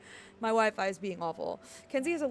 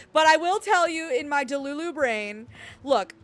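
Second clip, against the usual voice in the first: 1 excited gush; 3 semitones higher, 9 dB louder than usual. Real, not acted. Gushing over her game harem.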